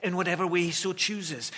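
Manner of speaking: ordinary talk, nothing marked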